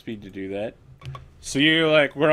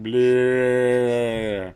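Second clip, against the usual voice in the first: elk bleat; 8 dB louder than usual. Acted. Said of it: "It recalls back at you by going".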